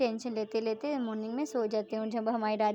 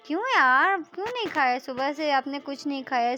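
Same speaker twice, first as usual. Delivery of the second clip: high, loud, insistent scold; a friend scolding another for skipping food; worried scolding; 4 semitones higher, 7 dB louder than usual. Acted. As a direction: push her to eat something. Scolding friend for not eating.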